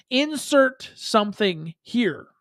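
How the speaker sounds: "Insert something here" drawn out word by word; slow, deliberate emphasis. Real, not acted. Spells out the makeshift show title.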